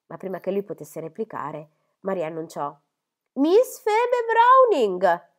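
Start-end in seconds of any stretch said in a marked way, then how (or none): 3.3-5.3 loud, very high call of the name; announcing. Acted. Maid announcing Miss Phoebe at the door, loud high call. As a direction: ring out the visitor's name across the house.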